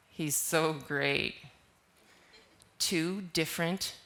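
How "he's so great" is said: sarcastic, lower and slow. Shown as a demonstration. Teacher demonstrating tone, heavy sarcasm. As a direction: drip sarcasm on so great. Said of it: "with a different tone of voice".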